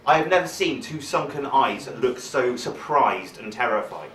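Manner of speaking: ordinary talk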